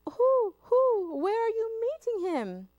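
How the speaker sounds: high, loud hooting owl; anxious owl. Acted. The owl asking where the gruffalo waits, high and loud. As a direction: hoot loudly and nervously.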